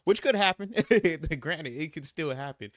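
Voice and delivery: high, loud laughing aside. Real, not acted. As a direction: concede it through a laugh. Laughs admitting fear was plausible.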